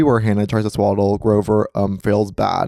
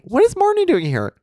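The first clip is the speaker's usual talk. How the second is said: surprised. Acted. Mock surprise recap.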